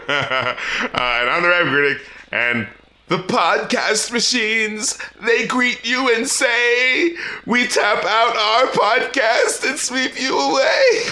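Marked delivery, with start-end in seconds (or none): 3.1-11.0 high, slow, stretched-out singer voice. Acted. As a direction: draw each phrase out high, like a sick lamb with a strong voice. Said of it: "trying to do a [name] voice".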